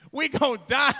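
high, loud comic terror; mock panicked cry. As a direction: cry out in hammed-up fright. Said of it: "they screamed out with fright".

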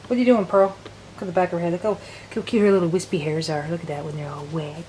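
normal talking voice

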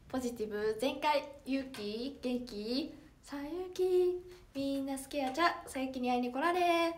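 idol catchphrase. Pretend. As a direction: chant it like a rehearsed idol introduction. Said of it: "自己紹介して".

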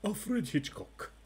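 slow, drawn-out Hitchcock imitation; Hitchcock impression. Trivia host announcing the answer to players, slow Hitchcock imitation. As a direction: intone it slowly like Alfred Hitchcock. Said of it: "That wasn't his accent either".

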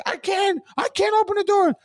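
high, loud desperate cry; panicked shriek. Retold or imitated. Panicked voice at the locked door, shrieking high. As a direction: wail that the door won't open.

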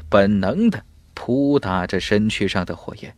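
ordinary delivery, nothing marked

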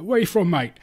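loud, high Cockney grocer; broad Cockney. Retold or imitated. Taken-in grocer asking a customer, broad Cockney accent. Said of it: "this really broad Cockney accent".

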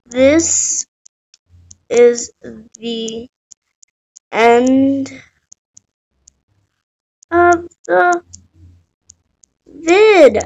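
word by word, long pauses between; halting sign-off. Real, not acted. Player ending the video while still playing, halting. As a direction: spread the sentence out, distracted, word after word.

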